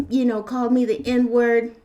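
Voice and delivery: ordinary delivery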